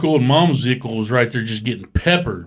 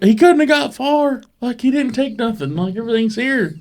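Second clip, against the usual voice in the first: high, raised mock panic; mock worried searcher; 13 semitones higher than usual. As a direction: play it up like a fretting search party. Retold search party worry.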